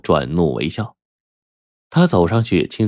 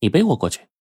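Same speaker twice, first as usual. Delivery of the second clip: put-on mildly petulant girl, a little higher; wilful demand; 4 semitones higher, faster than usual. A narrator's reading. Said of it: "任性地说".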